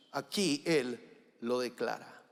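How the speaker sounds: ordinary delivery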